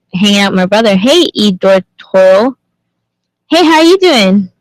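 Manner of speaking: ordinary delivery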